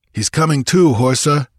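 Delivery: a touch of urgency in a warrior's call; lightly voiced warrior. A narrator's reading. Warrior tells comrade the fallen man is waking, mildly urgent.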